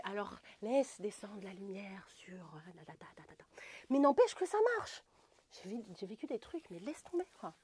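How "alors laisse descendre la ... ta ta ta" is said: mock yoga teacher whisper. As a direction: whisper it like a dreamy yoga guru. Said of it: "imite, euh, la prof de yoga dans sa yourte".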